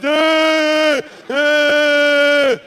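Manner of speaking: high, loud hammed-up wail over the hairdryer; mock wailing. Crying girl holding the hairdryer, loud high mock wail. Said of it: "she's crying, she's holding her hairdryer".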